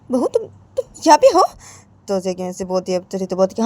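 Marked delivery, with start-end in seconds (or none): 0.1-1.4 high surprised question; surprised to hear her daughter-in-law; mother on the phone, high and surprised. Acted.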